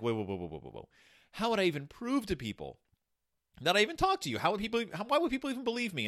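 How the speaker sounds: high, fast, anxious pleading; climbing up in worry; panicky disbelief. Retold or imitated. Moses panics about proving himself.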